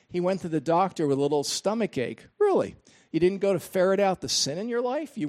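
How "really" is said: mock surprise. Mocking the preacher's choice.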